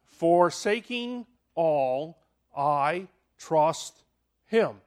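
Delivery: slow, measured recital; slow recitation. Shown as a demonstration. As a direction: spell out the acrostic slowly. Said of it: "learned this little acrostic for faith".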